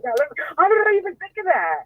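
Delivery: high, incredulous self-question; amazed at herself. Retold or imitated. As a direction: marvel at your own quick thinking. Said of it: "I was shocking myself".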